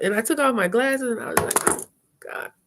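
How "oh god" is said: breathless whispered oh God through tears; choked whisper. Retold or imitated. She whispers while starting to cry. Crying after sad video.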